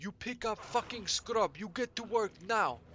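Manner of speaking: high-pitched mock Russian-style accent, barking orders; snapping thick accent. Kitchen boss snaps at teenage dishwasher, thick accent. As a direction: order them about in a thick Slavic accent. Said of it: "she would snap".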